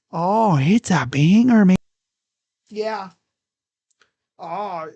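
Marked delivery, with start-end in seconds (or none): 0.1-1.8 louder, stretching out banger; Oh, it's a banger hollered out slowly; loud hype call. Acted. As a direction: belt it like a hype man. Repeating banger, loud take.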